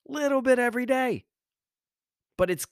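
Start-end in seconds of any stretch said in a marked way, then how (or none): 0.1-1.3 a high punchy repeat of Little bit every day; emphatic high repeat. Shown as a demonstration. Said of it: "repeated for emphasis".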